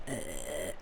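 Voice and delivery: low, quiet croak; croaky groan. Shown as a demonstration. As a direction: show off a croaky throat with a low uh.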